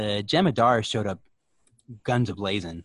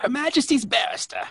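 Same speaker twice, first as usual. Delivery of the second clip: put-on British accent. Mock British court bit.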